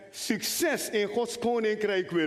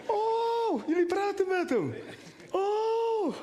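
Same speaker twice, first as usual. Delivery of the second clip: mock amazement; 13 semitones higher, much slower than usual. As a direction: overplay the surprise, way up high. Mock amazement at father's answer.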